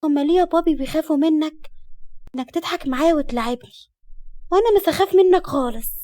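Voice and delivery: put-on high, innocent child's questioning; little girl voice. A narrator's reading. Small daughter asking why people fear daddy, childlike. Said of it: "الطفلة هزت راسها بموافقة وقالت".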